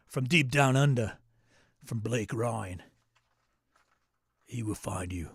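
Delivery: lightly put-on dramatic announcer; a hint of movie-trailer menace; mock ominous intro. Movie-trailer style question intro.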